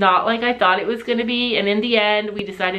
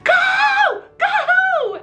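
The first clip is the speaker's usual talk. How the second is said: very high, louder drawn-out shrieks; imitated panicked screaming; 4 dB louder than usual. Husband screaming as the horses get close, panicked. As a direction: scream it out like a terrified man. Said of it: "Sam is screaming".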